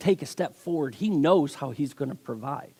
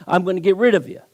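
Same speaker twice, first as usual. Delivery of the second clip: higher, louder, rapid scolding; upset shop owner; 5 semitones higher, 4 dB louder, faster than usual. Acted. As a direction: tell off a misbehaving pet, acted. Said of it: "The shop owner gets so upset".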